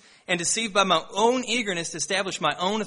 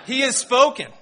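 higher, louder, booming prophet voice; mock proclamation; 6 semitones higher, 6 dB louder than usual. Teen son quoting the Lord to his mom, mock-booming.

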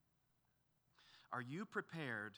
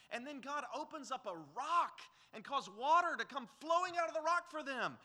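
higher, louder indignant preaching; preaching at full force; 8 semitones higher, 5 dB louder than usual. Acted. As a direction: rise in disbelief at the grumblers. Preacher exclaims over Israel's ingratitude.

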